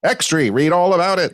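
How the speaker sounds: put-on old-time newsboy calling out; old newsreel voice. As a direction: announce it like a thirties newsreel. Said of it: "my old newsreel voice".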